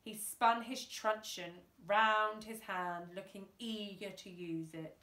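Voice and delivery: usual speaking voice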